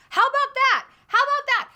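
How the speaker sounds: high, loud, triumphant jab; defiant challenge. Real, not acted. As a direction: throw it down like a challenge. Challenging with radical opinion.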